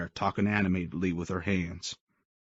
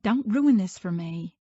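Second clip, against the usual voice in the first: put-on much higher, slow girl's voice; young woman pleading; 12 semitones higher, 4 dB louder, slower than usual. A narrator's reading. Mia warning Creed off her date, higher and slow. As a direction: beg him under her breath as Mia. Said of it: "she mouthed".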